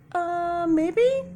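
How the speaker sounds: high, hesitant mock card; a shy card wavering; unsure card voice. A doubtful card answering the reader, high and hesitant. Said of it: "And some are like".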